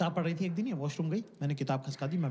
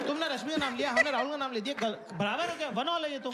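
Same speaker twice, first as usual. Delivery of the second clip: indignant protest; 10 semitones higher than usual. Retold or imitated. Husband pleading with his crying wife, high and exasperated. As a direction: protest it's unfair, voice way up.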